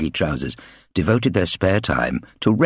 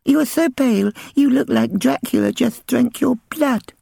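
put-on high, lisping small child; lisping little girl. Little sister questioning the bullies, innocent lisp. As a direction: ask it with a little girl's lisp. Eliza's voice.

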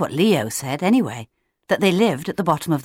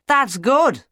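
raised, projected reply; booming back; 6 semitones higher than usual. A narrator's reading. Claudius to the chick on his feet, booming. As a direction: boom it down to a small chick. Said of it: "Claudius boomed back".

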